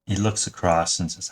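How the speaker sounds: usual speaking voice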